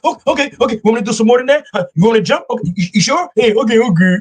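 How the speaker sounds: high, fawning eager voice; mock an overeager coworker, pitched high; eager people-pleaser. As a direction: play the overeager yes-man. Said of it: "every other guy be like".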